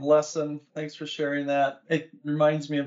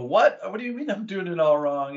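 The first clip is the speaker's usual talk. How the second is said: incredulous retort; 4 semitones higher than usual. Retold or imitated. Tennis coach answering the mentor's criticism, stunned and defensive. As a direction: bristle as if your skill was just insulted. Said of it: "And you go".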